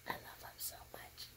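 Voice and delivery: soft, breathless whisper through tears; tearful whisper. Real, not acted. Missing her father, whispering through tears. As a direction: barely breathe it out, crying. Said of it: "why I'm crying".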